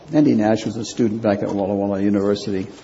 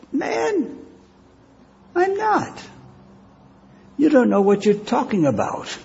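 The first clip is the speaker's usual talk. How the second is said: very high, strained protest; desperate denial; 13 semitones higher than usual. Acted. Peter cornered by an accuser, shrill desperate denial.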